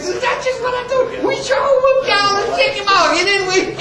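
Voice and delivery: put-on very high, hilarious old-soldier crowing; high-pitched gleeful taunt, breaking into laughs; dying laughing. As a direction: crow it through helpless mock laughter. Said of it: "this guy's dying laughing".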